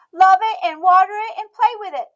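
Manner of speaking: put-on high, bright little girl voice, loud and proud; a stubborn little girl declaring herself; Emmy's child voice. A narrator's reading. Emmy speaking.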